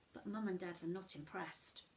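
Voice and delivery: usual speaking voice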